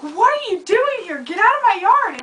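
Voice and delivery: high, loud mock yell to get out; retold screaming. Chasing the naked man out of her yard, a loud yell. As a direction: scream him off the lawn. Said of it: "I just started screaming at him".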